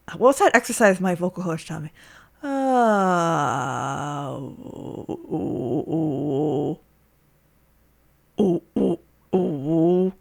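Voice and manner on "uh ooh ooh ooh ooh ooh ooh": very low, soft ooh; deep voice. Shown as a demonstration. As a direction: sink the voice deep as a vocal exercise. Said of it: "do a deep voice".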